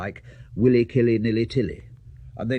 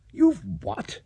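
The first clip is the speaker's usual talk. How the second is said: high, startled outburst; stunned he's stuck small; shocked shout; 13 semitones higher than usual. Acted. Bobby hears the magic word is lost, shocked high outburst. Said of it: "shouted Bobby Brewster".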